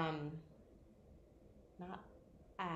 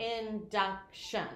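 "Induction" drawn out, jaw dropping on each vowel; slow syllables; 2 semitones higher, slower than usual. Shown as a demonstration. Student using the chin trick to count syllables, slowly. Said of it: "the chin thing".

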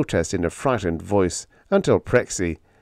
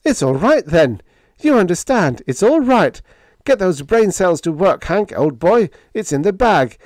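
high, loud, jubilant outburst; enthusiastic; 11 semitones higher, 7 dB louder than usual. Acted. Biggs pounding Hank on the back, jubilant. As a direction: cheer him on with rising excitement. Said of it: "pounding him enthusiastically upon the back".

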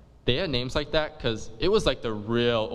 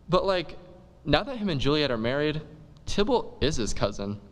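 usual speaking voice

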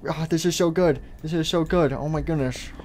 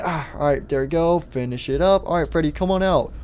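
ordinary delivery

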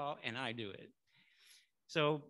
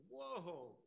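startled, backing off; 11 dB quieter than usual. Retold or imitated. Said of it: "And he backed up".

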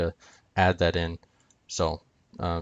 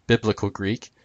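normal talking voice

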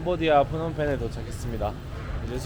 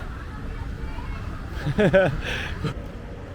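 laughter; 5 semitones higher than usual. Real, not acted. Laughing at odd alley.

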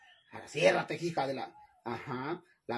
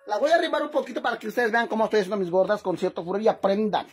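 loud boast; 2 semitones higher, 7 dB louder, faster than usual. Real, not acted. Boasting lesson to viewers.

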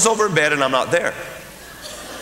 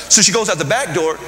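ordinary delivery

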